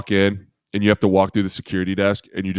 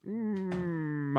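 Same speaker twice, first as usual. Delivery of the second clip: doubtful hum; 11 semitones higher, 9 dB quieter than usual. Retold or imitated. Players doubting the coach's plan.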